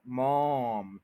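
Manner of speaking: मॉम drawn out long; stressed syllable. Shown as a demonstration. Teacher repeats the stressed syllable, stretched. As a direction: linger on the vowel of मॉम. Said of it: "ये stress है".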